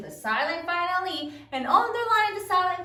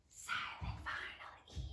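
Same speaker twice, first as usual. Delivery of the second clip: hushed, voiceless whisper; 16 dB quieter than usual. Shown as a demonstration. Teacher names a silent letter while sounding out, whispered.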